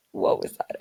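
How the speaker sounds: startled whisper, no voice at all. Real, not acted. Startled by a noise while recording, she whispers. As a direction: hush it, as if a strange noise just went off outside.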